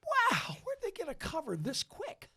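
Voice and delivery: high-pitched marvelling wow; amazed. Retold or imitated. Singer marvels at his supposed cover, high and astonished. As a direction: wonder aloud, pitch way up.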